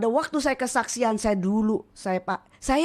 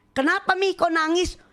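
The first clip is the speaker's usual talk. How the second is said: concerned husband; 7 semitones higher than usual. Retold or imitated. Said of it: "suami saya bilang gini".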